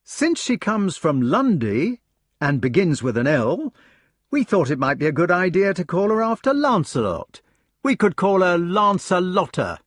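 high, bright and hopeful; eager explaining. Acted. Gareth proposing the falcon's name, high and eager. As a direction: pitch the name proudly to the brothers.

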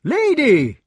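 high, loud call after her. Acted. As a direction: call her back sharply. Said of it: "said Lancelot".

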